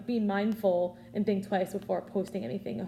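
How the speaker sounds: ordinary talk, nothing marked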